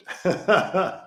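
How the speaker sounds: high put-on evil laugh; wicked Grinch cackle; villain cackle.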